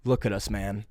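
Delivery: low, soft reflective remark; buddy's musing. Retold or imitated. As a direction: imitate your buddy quietly taking stock. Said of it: "here's what he said".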